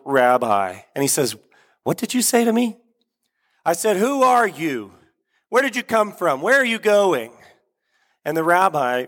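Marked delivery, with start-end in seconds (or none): 1.8-2.8 high-pitched, incredulous question; acted disbelief, pitched way up; startled rabbi. Startled rabbi answers soldier's challenge. 3.7-7.3 high, insistent sentry challenge; acted commanding soldier; big booming voice. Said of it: "big booming voice".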